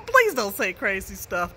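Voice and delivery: normal talking voice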